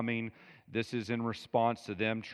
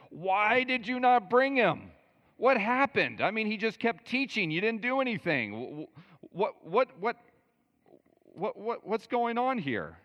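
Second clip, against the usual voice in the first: high, loud, incredulous demand; hammed-up indignant boss, stammering at the top of the voice; exasperated official; 12 semitones higher, 5 dB louder than usual. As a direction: sputter questions in high-pitched disbelief. Acts out officials scolding the guards.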